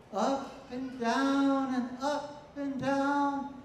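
exaggerated rising and falling pitch, slow; singsong up and down. Shown as a demonstration. Teacher imitating students' sing-song English rhythm. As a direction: mimic a sing-song tonal rhythm, slowly. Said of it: "sometimes you, you speak kind of".

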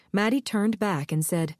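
ordinary delivery, nothing marked

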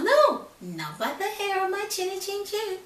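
high, loud little pig refusing. Acted. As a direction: squeak it out defiantly.

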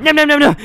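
loud, high nom-nom chomping; greedy munching. Acted. Contestant racing through food on a countdown, greedy munching. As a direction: gobble noisily in a burst. Speed-eating against a count.

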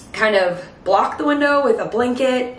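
ordinary talk, nothing marked

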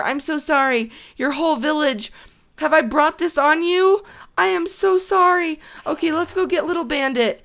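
ordinary talk, nothing marked

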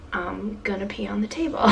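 whispered joke about needing to pee; hushed, half-laughing confession. Retold or imitated. To the viewers about the long wait, whispered.

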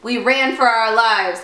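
ordinary delivery

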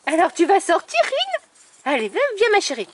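high, bright coaxing call to a puppy. Real, not acted. Breeder coaxing her puppy Rin outside, high coaxing call. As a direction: sing it out to coax the pup.

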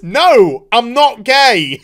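loud, slow mock protest; emphatic denial. Kara correcting her friend, emphatic denial. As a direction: stretch out an indignant denial. Heroine loudly denies it.

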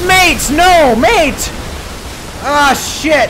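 high, loud panicked protest; panicked yelling. Real, not acted. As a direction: yell at the enemy in panic. Enemy attacking, panicked.

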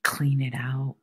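breathy whispered urging. Real, not acted. Host whispering to listeners about healing. Spiritual podcast, soft urging.

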